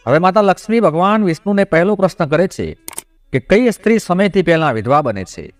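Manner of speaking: plain reading voice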